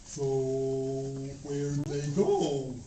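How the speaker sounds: a hint of a lumbering monster; monster asks. Bally Sally Cotto asking Grandma where the kids went, low and slow. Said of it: "he says to her, he says".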